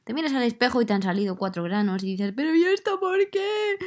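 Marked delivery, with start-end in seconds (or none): 2.4-3.8 high-pitched dramatic whine of dismay; hammed-up "why me" outburst; exasperated complaint. Acted exasperated complaint at mirror.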